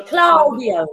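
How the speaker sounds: trying "Claudio" with an open "a"; attempted pronunciation. Shown as a demonstration. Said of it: "How do we pronounce it?".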